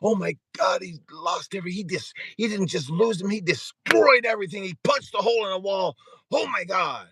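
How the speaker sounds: high, breathless mock gasping; mock gossiping crowd. Everybody gawking at his disaster, high mock-shocked gossip. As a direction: ham up the gawkers relishing the drama. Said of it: "everybody's like".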